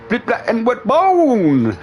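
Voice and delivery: high, drawn-out "bone" punchline; mock a rehearsed drop, stretching the last word high; mimicking friend's delivery. Teasing a mate over his mirror practice, high slow mimicry. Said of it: "it's the way you dropped it".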